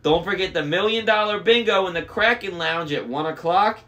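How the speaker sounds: high, loud, chirpy intercom announcer; bubbly cruise announcer; way too happy. A narrator's reading. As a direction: announce it with over-the-top cheer. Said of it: "sounded way too happy".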